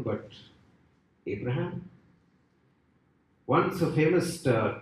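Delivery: usual speaking voice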